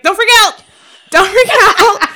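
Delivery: high, loud, punched panic; half-laughing yelp of alarm, retold; panicked plea. Telling friends how the bird came straight at her, panicked.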